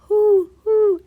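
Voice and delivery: high hooting owl call. Acted. Owl calling outside the window, high hoot. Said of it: "called the owl outside".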